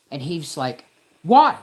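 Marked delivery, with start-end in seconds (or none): 1.2-1.6 snippy, defiant officer voice; a cop with real attitude, mocked; mocking cop attitude. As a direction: mimic his lippy tone. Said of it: "and, like, real attitude".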